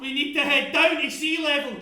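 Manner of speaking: loud, high urgent counter-order; screaming. Acted. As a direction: shout a rival plan over the fire. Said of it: "Charlie's screaming".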